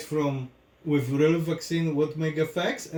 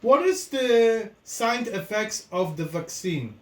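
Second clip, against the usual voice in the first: talking to voice assistant; 7 semitones higher, 4 dB louder than usual. Real, not acted. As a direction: speak up clearly to the assistant. Asking the phone assistant.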